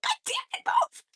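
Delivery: high, hushed exasperated squeal; quiet high-pitched frustration, played for laughs; exasperated retold outburst. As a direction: squeal it through suppressed laughter. Retold snapping at woman in way.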